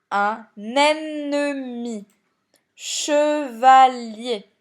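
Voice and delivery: "un ennemi. Chevalier." very slow, en-ne-mi, che-va-lier; syllable by syllable. Shown as a demonstration. Teacher giving examples without accents, "un ennemi. Chevalier." slowly split by syllable. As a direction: stretch and separate each syllable of "un ennemi. Chevalier.". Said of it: "dernière lettre de la syllabe".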